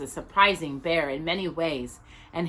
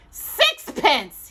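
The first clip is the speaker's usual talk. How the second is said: bitterly; 8 semitones higher, 5 dB louder than usual. A narrator's reading. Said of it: "he said bitterly".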